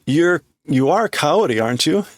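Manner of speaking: normal talking voice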